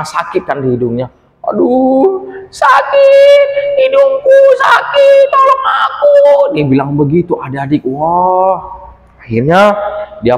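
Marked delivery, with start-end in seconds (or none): 1.5-6.2 very high, loud, slow wailing; sobbing little creature calling for help; crying in pain. Acted. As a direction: cry out for help, nose hurting. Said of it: "sambil menangis".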